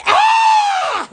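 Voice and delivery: sudden high yelp of surprise; startled yelp. Real, not acted. Startled by something on camera, sudden high yelp. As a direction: jump and yelp, caught off guard.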